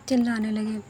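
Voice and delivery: usual speaking voice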